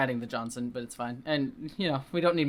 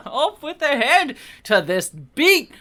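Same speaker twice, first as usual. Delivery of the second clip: high, slow, loud decree through laughter; mock tyrant decree; 10 semitones higher, 6 dB louder, slower than usual. Gleeful mock tyrant ordering executions to the beat.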